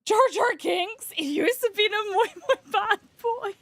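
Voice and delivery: high, loud, goofy alien voice; hammed-up squawky cartoon creature; Jar Jar Binks. Streamer attempting a requested voice, hammed-up high Jar Jar. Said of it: "Can I do Jar Jar Binks?".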